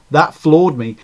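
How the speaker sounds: plain reading voice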